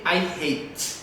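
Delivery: "hate" ending on an audible T; final T sounded. Shown as a demonstration. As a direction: say "hate" with no vowel after the T. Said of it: "a gente escuta o T no último som".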